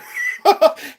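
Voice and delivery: short high laugh. Real, not acted. Streamer teasing a friend in chat, high laugh. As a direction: let out a quick high laugh.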